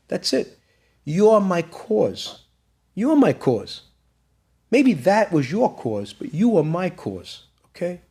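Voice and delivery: high, drawn-out insistence; emphatic plea. Retold or imitated. Son telling his father who his cause is, emphatic and pleading. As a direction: retell a son pressing his point, each phrase slow and weighted.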